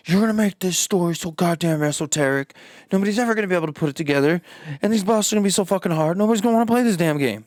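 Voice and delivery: high, loud, fast ranting boss; publisher voice. Retold or imitated. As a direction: rant like a gleeful publisher exec. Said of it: "Bandai's just like".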